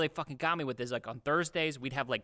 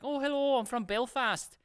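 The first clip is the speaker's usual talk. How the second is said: put-on Irish accent; 10 semitones higher than usual. Bored salesman greeting shopper, put-on Irish accent. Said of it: "I was doing an Irish accent".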